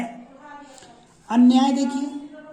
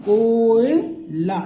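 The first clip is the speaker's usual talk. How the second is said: कोयला drawn out very slowly and louder; slowly stretched demo; 2 semitones higher, 5 dB louder, much slower than usual. Shown as a demonstration. Teacher to students, pronouncing कोयला very slowly and louder. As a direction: stretch every sound of कोयला. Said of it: "का बना कोयला".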